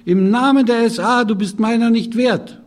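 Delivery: a high, loud mock-dramatic shout. Hans kneels over his wife with a dagger and shouts. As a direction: bellow it like a mock threat.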